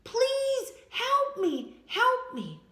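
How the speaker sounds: slow, high, loud "Please help me!"; desperate scream for help. Acted. Injured girl calls out for anyone to help. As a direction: drag out the plea, wailing.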